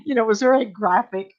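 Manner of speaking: ordinary talk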